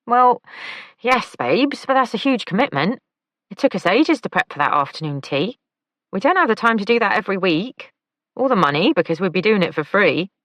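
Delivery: high, lively camp man; camp male voice. A narrator's reading. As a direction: lilt it up, high and playful. Oliver's voice in audiobook.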